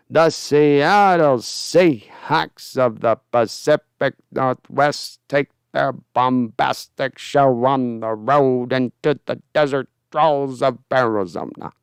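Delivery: very slow, bombastic sportscaster cadence; Howard Cosell impression. Streamer showing Cosell's cadence to chat, very slow. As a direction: drag out each phrase like Cosell. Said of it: "Cosell ... his cadence".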